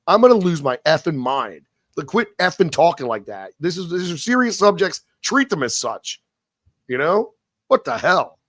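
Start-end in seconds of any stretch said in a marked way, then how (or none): none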